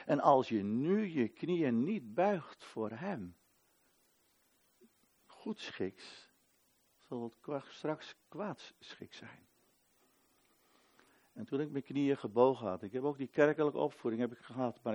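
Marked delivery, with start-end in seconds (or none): 5.3-9.5 soft, very slow, ominous; preacher warning the unbowed, slow and hushed. Acted. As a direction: drop it to a grave near-whisper. Solemn warning, dropped low.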